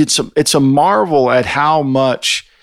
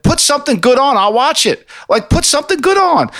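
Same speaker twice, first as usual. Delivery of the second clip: exasperated plea; 11 semitones higher than usual. Real, not acted. To listeners who mock his old references, a high exasperated plea. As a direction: pitch climbs high as he pleads with them. Ranting at critics about TV.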